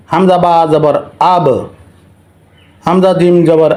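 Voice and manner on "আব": "আব" with an echoed final b; qalqala bounce. Shown as a demonstration. Teacher shows viewers qalqala on ba, bouncing the b of "আব". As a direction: push the final b of "আব" open into a tiny extra vowel. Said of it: "ধাক্কা দিয়ে পড়িতে হয়".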